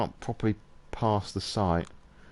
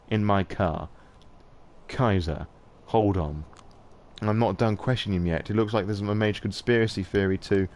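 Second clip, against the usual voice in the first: normal talking voice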